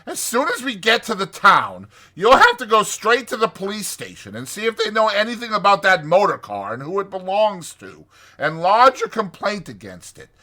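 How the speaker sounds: brisk, scolding Rat voice; sharp. A narrator's reading. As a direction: order Toad about sharply. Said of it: "said the Rat sharply".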